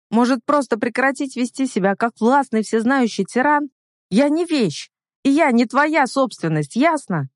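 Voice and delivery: high, indignant outburst; angry defiance. A narrator's reading. Heroine refusing to be his property, angry defiance. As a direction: stand up to him, voice pitched high. Heroine defies controlling man in quarrel.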